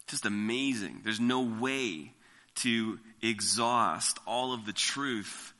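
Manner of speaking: usual speaking voice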